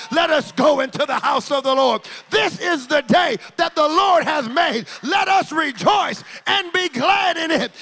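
loud, high shouted scripture; fiery preacher shouting scripture; full-force preaching. Acted. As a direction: preach it to the rafters.

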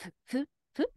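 simple-minded rat grunting single words; dumb rat voice. Retold or imitated. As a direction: blurt words like a hungry rat. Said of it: "they were more like".

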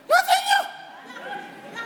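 very high, drawn out laugh. Real, not acted. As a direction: laugh it out slowly. Laughing emphasis.

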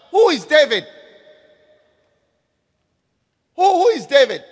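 loud, contemptuous challenge; scornful Nabal. Acted. Nabal rebuffing David's servants, loud and scornful. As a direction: sneer it out like an offended rich man. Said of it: "Nabal answered and said".

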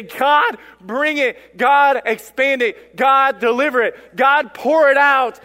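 punchy, high, drawn-out petitions; impassioned plea. Acted. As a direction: call out each plea with full force. Voices church crying out to God.

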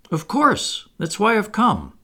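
put-on high, mildly stern boyish insistence; a little stern. A narrator's reading. Peter tells Wendy why he came, a little sternly. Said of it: "he added a little sternly".

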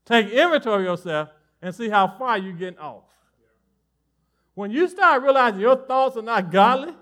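loud, driving sermon delivery; fired-up preaching. Acted. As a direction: preach it to the congregation with force. Preaching at full force.

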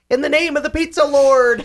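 high-pitched imitated war cry; screaming Toot Toot. Host imitates Toot Toot screaming out of the valise, high-pitched. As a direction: cry it out high, like a tiny charging warrior. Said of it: "comes screaming out and says".

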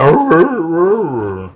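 mock dog barks; dog barking. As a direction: bark like a dog. Dog voice for Brian.

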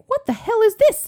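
high, loud outraged question; shocked mom. Retold or imitated. Mom sees the bandage, high and outraged. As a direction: shriek it like an alarmed mother.

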